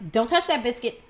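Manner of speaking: lightly voiced scolding grandmother; a hint of a strict old grandma; stern grandma. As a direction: warn them off the biscuit like grandma. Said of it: "Grandma Sadie's was like".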